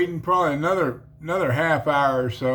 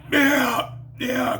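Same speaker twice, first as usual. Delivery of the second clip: high, strangled yes; scared stiff, answering the devil; terrified; 10 semitones higher than usual. Retold or imitated. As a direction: squeak it out in panic.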